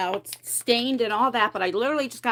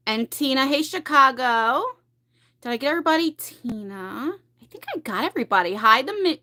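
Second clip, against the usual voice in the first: ordinary delivery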